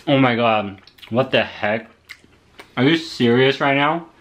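usual speaking voice

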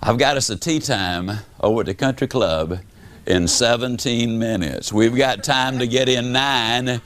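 low, easygoing buddy voice; golf buddy on phone. Retold or imitated. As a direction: invite him like a relaxed golf pal. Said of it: "Voice at the other end says".

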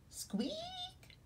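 a tiny rubber duck's whispered squeak. Acted. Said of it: "says the little rubber duck".

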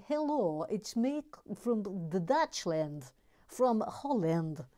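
slow, attempted Dutch accent; rough go at a Dutch accent. Shown as a demonstration. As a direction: put on a phlegmy Dutch voice. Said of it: "if I can do a Dutch accent".

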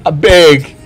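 put-on Nigerian accent. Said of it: "You spoke purely in the Nigerian accent".

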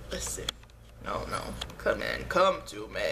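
low, creepy mad scientist voice, beckoning. Pretend. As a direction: lure them in like a mad scientist. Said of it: "that was creepy".

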